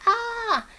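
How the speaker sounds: sudden high shriek; frightened scream. Retold or imitated. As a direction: shriek at the sight of the monster. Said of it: "It's like".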